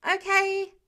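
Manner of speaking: high, loud okay; loud reply. Acted. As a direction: call back an eager okay. Peter answering the shouted order.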